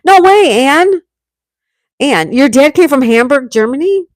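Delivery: astonished, pitch leaping up; real amazement. Real, not acted. Streamer amazed by Ann's dad coming from Hamburg, surprised. Reacting to chat's Hamburg coincidence.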